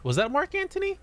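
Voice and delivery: squeaky high disbelieving repeat; mock surprised echo. Echoes the question very high.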